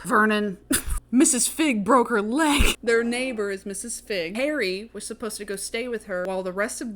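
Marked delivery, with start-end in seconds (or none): 0.0-2.8 laughing. Retold or imitated. Gleeful wife tells husband news.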